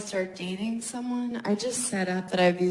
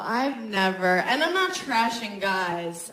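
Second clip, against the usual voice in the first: normal talking voice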